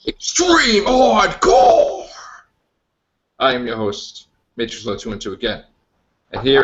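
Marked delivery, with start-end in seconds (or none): 0.1-2.1 slow, high, booming title yell; hype announcer shout. Acted. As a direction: announce it like a wrestling show. Show title announced dramatically.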